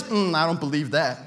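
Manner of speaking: low unimpressed disbelief; skeptical doubt. Retold or imitated. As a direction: scoff like you've heard it all. Skeptical congregant.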